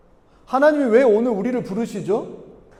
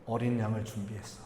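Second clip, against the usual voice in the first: lightly voiced brother, gently urging in a lowered tone; quiet, measured appeal to an older brother; younger brother's appeal; 6 semitones lower, 10 dB quieter than usual. Preacher voices Abel urging his brother.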